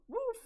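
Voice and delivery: high, loud single woof; one sudden sharp woof; dog bark. Acted. Dog character barks back.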